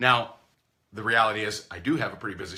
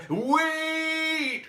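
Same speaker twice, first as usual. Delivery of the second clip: calling out. Retold or imitated. Prospect calling out to stop the call, high cry. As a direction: call them back before they hang up.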